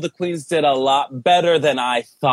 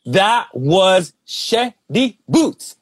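slow drawl; much slower than usual. Acted. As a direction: stretch each word out slowly. Drawn-out catchphrase.

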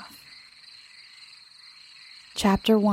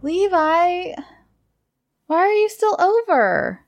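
shouting; 10 semitones higher than usual. A narrator's reading. Girl wakes at night and calls Levi, shouting. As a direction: call out higher and higher, stretching it. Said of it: "Kingsley shouted".

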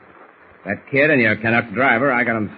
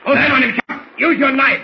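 high, louder desperate yell; panicked yell; 6 dB louder than usual. Acted. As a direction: scream the order in panic. Bull yelling orders in panic.

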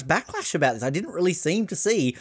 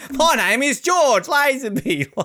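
high, slow hammed-up Bond intro; playful Bond catchphrase; mock Bond line; 10 semitones higher, 4 dB louder, slower than usual. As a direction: deliver it like a suave Bond. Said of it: "as in".

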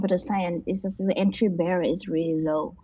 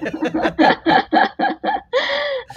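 laugh; 5 dB louder than usual. Real, not acted. Laughing at friend joke.

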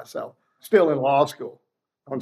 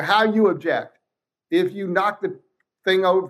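normal talking voice

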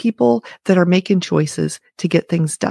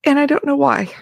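tearful; 7 semitones higher than usual. Real, not acted. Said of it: "bringing tears to my eyes".

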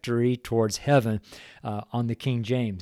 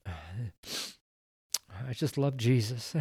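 tearful; 4 semitones lower, 10 dB quieter, much slower than usual. Real, not acted. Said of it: "I'm a very emotional man".

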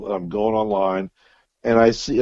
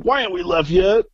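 impatient whine; 9 semitones higher than usual. Retold or imitated. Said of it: "is like".

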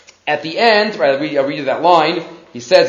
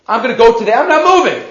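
climbing higher into a protest; stubborn refusal; 4 semitones higher, faster than usual. Acted. The cow refusing to go to the prophets, rising stubborn protest. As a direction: act out a balking animal digging in.

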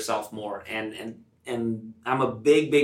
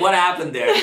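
mock bewildered; 7 semitones higher, 6 dB louder than usual. Mock puzzled self.

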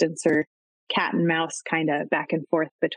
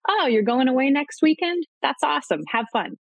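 higher, bright-sounding easygoing partner, lightly acted; mild mock of a carefree secure date; breezy, cheerful approval; 7 semitones higher than usual. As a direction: play it relaxed and genuinely happy for them. Secure date encouraging time apart.

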